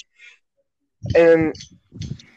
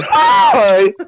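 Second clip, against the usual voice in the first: high, loud wheezed greeting; lung-problem hi; 7 dB louder than usual. Retold or imitated. Friend mimics a guy's hi, wheezy and strained. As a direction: squeeze out a loud, strained mock hi. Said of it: "had lung problems whenever he said hi".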